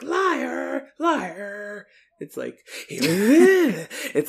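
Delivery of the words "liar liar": high, loud mock old-woman cry; witch doctor's wife. Wife cutting in on her husband's story, high accusing cry. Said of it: "the wife is like ... she's like".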